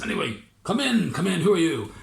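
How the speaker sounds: high, loud welcoming mayor; Lord Mayor. Acted. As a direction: call them in, pitched high and loud. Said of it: "he says".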